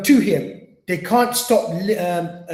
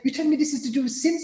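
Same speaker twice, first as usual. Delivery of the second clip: incredulous doubter; 8 semitones higher than usual. Retold or imitated. Doubters quoted in disbelief.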